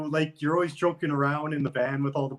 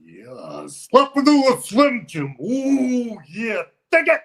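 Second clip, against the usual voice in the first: much higher, louder wild gravelly yell; Macho Man impression; 9 semitones higher, 8 dB louder, slower than usual. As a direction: hype the Slim Jim like the Macho Man. Macho Man Slim Jim catchphrase.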